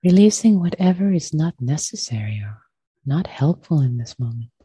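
unhurried meditation voice with long pauses; guided meditation. Acted. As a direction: gently guide them into stillness, leaving space after each phrase.

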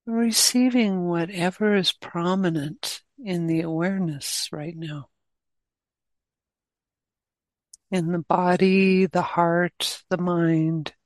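guided meditation. Real, not acted. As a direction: guide them gently, leaving long pauses between phrases.

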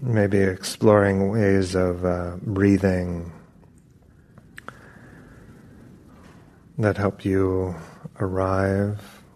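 unhurried meditation guidance with long pauses; calm, even voice, drawing out the phrase endings; guided meditation. Acted. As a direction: ease them gently into stillness, phrase by phrase.

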